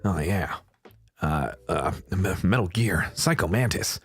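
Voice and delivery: low, softened game-hero voice; Snake. Shown as a demonstration. Warming up the Metal Gear voice, low Snake impression. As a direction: drop low to find the character. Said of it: "let me get into the snake voice".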